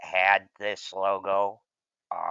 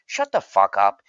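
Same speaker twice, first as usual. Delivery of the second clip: louder, cutting them off hard; angry retort; 7 dB louder than usual. Real, not acted. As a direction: tell the mockers off with real annoyance. Snapping back at mocking fans.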